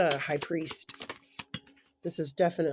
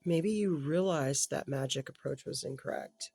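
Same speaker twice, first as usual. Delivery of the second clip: ordinary talk, nothing marked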